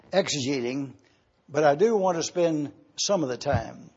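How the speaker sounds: ordinary delivery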